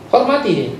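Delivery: ordinary delivery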